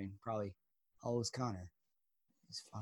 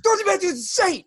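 high, fast yell of a movie line; hammed-up high-pitched holler; mock yell; 4 dB louder, faster than usual. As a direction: holler it much higher, all in a rush. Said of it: "I'll just yell out the window".

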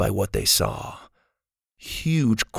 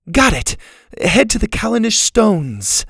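put-on high, eager girl's voice; excited discovery; 10 semitones higher, 4 dB louder than usual. A narrator's reading. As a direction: announce the find, louder and high. Girl finally finds the answer.

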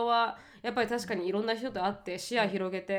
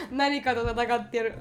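laughing; 4 semitones higher, slower than usual. Real, not acted. Laughing fit after sneeze joke.

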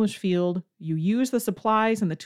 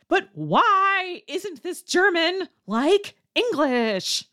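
high, slow, whiny mock-learner question; mock whine of a confused learner; 9 semitones higher, slower than usual. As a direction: drag out the why like a whining student. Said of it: "it reads like this".

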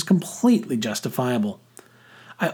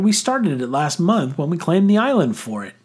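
raised-pitch defensive insistence; insistent cry; 6 semitones higher than usual. A narrator's reading. Boy defending his pirate club, insistent cry. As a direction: insist on the claim. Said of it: "I cried".

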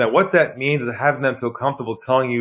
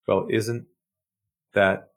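calm, quiet, unhurried and knowing; lowered voice; 4 semitones lower, 6 dB quieter than usual. Retold or imitated. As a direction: drop the voice and let the last word fall away breathlessly. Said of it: "I lowered my voice".

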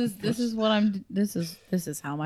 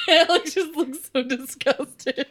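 laughing; 8 semitones higher, slower than usual. Real, not acted. Laughing at cowboy voice bit.

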